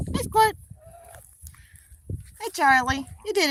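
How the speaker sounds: high, lilting praise. Real, not acted. Owner praising her goose: high praise. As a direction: reward the bird with a sing-song.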